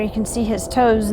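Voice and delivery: ordinary delivery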